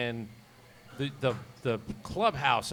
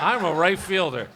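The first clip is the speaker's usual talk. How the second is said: loud, raised-pitch comic announcement; mock eager declaration; 4 semitones higher, 9 dB louder than usual. Host jokes as the player accepting a new position, mock emphatic. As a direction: belt it out as a joke, playing the compliant rookie.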